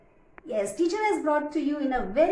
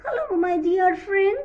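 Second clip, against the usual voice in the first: friendly mouse; 7 semitones higher than usual. Acted.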